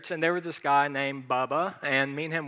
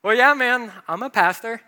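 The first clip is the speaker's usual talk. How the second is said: louder and higher, owning it boldly; confident declaration; 6 semitones higher, 8 dB louder than usual. Retold or imitated. Pastor confirming his job to a surprised gym friend, loud and bold. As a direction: say it proudly, no hiding now. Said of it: "I was like".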